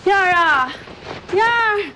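loud, high call of a name. Acted. Older woman calling after the young woman, calling out. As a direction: call after her urgently.

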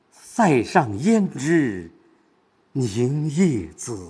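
凝夜子 said slow and held inward; slow down on 凝夜子, weight turned inward; inward stress. Shown as a demonstration. Teacher showing the heavy inward stress, slow. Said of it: "它是往心里走，往内里走".

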